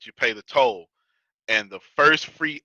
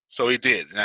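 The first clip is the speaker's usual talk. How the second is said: normal talking voice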